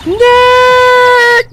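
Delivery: very high, long-held yell. Acted. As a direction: stretch the name into a piercing call. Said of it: "I'm not saying it loud enough".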